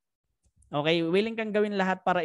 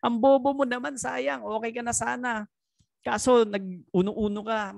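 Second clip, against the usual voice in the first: scornful critics; 7 semitones higher than usual. Retold or imitated. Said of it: "marami ding nagsabi sa akin na".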